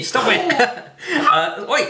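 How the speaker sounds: laughing while being tickled; giggling protest through laughter. Real, not acted. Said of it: "Phoebe's right down by my legs and tickling me".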